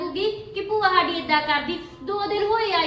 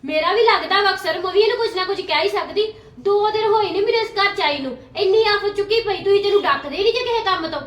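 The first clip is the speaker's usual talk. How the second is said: loud, heated complaint; aunt loudly defending herself to her sister-in-law; angry retort; 3 semitones higher, 7 dB louder than usual. Acted. Sister-in-law argues back loudly.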